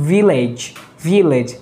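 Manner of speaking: "ভিলেজ" as village with a buzzing v; English V sound. Shown as a demonstration. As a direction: teeth on lip to start "ভিলেজ". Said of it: "এই সাউন্ডটা হবে". ("ভিলেজ" ইংরেজি v ধ্বনিতে)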